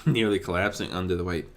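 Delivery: plain reading voice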